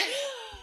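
high, soft burst of giggling; helpless laughter. Real, not acted. Podcaster interrupting herself mid-sentence, helpless laughter. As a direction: break off into high-pitched laughter. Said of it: "I'm not sorry for laughing".

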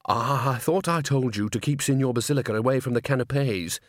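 plain reading voice

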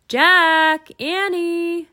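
loud, high, drawn-out names; mother hollering from far off; carrying call for the children. A narrator's reading. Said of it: "their mom called again".

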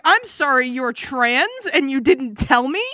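loud, high, shocked question; shocked disbelief. Acted. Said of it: "Mateus is like".

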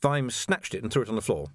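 ordinary delivery

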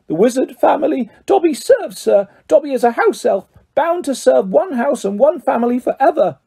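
high, dutiful house elf; Dobby, humble. A narrator's reading. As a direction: servile little creature, pitched high.

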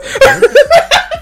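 high burst of mocking laughter. Real, not acted. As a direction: cackle at the idea.

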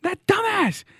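high-pitched comic outburst; outraged rant. Pretend. Comedian complains about the new Geico ad character, high mock outrage. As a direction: blow up in mock outrage, voice shooting up.

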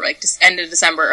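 ordinary talk

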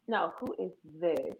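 soft, slow who-is-this; cold challenge. Acted. Sister on her brother's phone, soft and icy. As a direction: drop it low and cool.

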